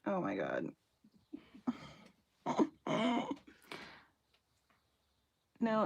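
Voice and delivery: ordinary talk, nothing marked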